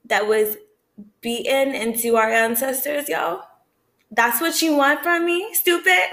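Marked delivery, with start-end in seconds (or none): 4.1-6.1 high-pitched, rising incredulous question; indignant challenge. Real, not acted. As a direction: demand it in offended disbelief. Indignant challenge to imagined Christmas pushers.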